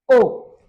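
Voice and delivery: "O" called very loud with rounded lips; closed-mouth vowel O. Shown as a demonstration. Teacher drills pupils on "O", loud with closed rounded lips. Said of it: "Tu fermes vraiment la bouche".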